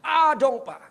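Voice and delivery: high, loud, decisive answer; firm, confident. Retold or imitated. Driver repeats his answer to preacher, firm and confident. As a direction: belt out a sure answer. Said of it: "Dia jawab dengan mantap".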